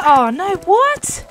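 loud, high cry of disbelief; shocked dismay. Real, not acted. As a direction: burst out in real shock. Dismay at the race result.

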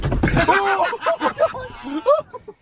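high relieved laughter. Real, not acted. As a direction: laugh it off after the scare. Relieved laugh after scare.

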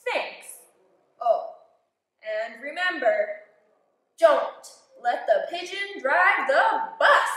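a friendly driver handing over the bus; a hint of a bus driver's voice.

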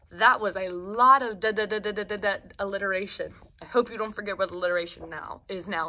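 plain reading voice

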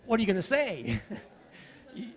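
normal talking voice

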